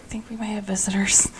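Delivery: hushed, breathy warning whisper; a quick unvoiced whisper of warning. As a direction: whisper it like a secret to the room. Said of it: "Are you whispering that to the dead bodies?".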